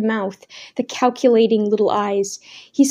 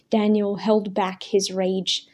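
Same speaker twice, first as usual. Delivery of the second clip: plain reading voice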